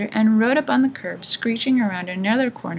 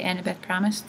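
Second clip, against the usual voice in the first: ordinary delivery, nothing marked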